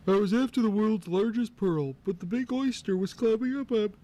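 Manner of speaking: first crab voice. Acted. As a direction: voice Krabby high and cartoonish. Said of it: "Let me start over".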